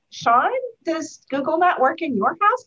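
high, slow, mock-innocent question; teasing comeback. Turns CEO's catchphrase back on him.

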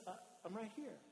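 hushed, tender reassurance; quiet and comforting; gentle reassurance. Acted. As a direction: softly let them know you're near. Jesus reassures frightened Peter.

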